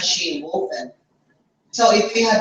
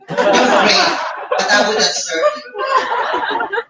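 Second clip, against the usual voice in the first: high laughing teasing line, louder; laughing tease; 13 semitones higher, 3 dB louder, slower than usual. Real, not acted. Teasing her husband about not serving him, laughing.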